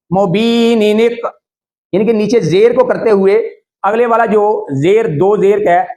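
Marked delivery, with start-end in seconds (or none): 0.1-1.2 मुबीनिनिक chanted slowly, long "bee", linked "ni-nik"; joined recitation. Shown as a demonstration. Teacher repeating the link, slow chanted मुबीनिनिक. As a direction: run मुबीनिनिक together without a pause. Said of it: "continue तसलसल के साथ पढ़ूंगा".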